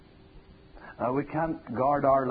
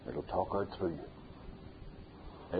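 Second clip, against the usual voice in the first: slow, much softer and lower; hushed echo; 5 semitones lower, 9 dB quieter, much slower than usual. Acted. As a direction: let "It'll talk out through you" sink in quietly. Preacher repeats his point, softly.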